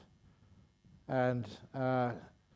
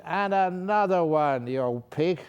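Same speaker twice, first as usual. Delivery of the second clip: louder, higher, slow rude demand; 5 semitones higher, 6 dB louder than usual. Retold or imitated. Rude passenger demands more whiskey from the attendant, loud and slow. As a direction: bark for another drink. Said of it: "just said".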